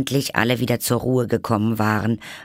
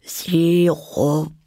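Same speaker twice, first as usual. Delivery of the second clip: sleepy voice; 8 semitones higher, 4 dB louder than usual. A narrator's reading. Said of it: "sagte eine schläfrige Stimme".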